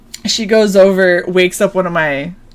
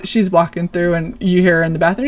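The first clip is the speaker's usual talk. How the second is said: ordinary talk, nothing marked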